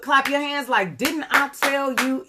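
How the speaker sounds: high, emphatic mock telling-off; slow down and punch it like a cross mom; scolding mom. Imitating a mom who claps while telling off, scolding emphatically. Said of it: "your mom be like".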